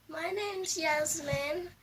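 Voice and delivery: ordinary talk, nothing marked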